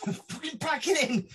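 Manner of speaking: high, drawn-out angry order; exasperated outburst. Retold or imitated. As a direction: tell a noisy roommate off, slowly. Teller orders roommate to stop.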